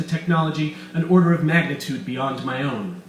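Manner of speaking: loud, gloating mad scientist voice; villain Weiss. Acted. Doctor Weiss gloating to his captives, loud and smug. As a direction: boast like a scheming villain.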